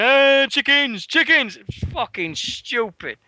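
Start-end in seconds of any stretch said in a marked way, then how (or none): 0.0-1.6 playful call. Retold or imitated. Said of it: "and we're like".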